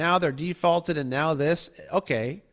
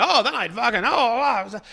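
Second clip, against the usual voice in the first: higher, sputtering mock bluster; spluttering purist; 7 semitones higher than usual. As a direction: choke on your own confidence. Mocking flustered purist.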